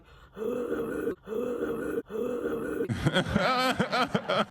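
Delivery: loud, drawn-out possessed noises breaking into cackles; mock movie-demon roar, high and loud; imitated demon growling. Imitating possessed people in exorcism movies, loud demonic noises. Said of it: "those exorcism movies and they're just like".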